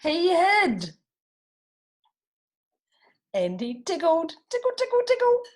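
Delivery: high, loud giggly tickle; playful tickling. A narrator's reading. Reading the tickling to children, high and playful.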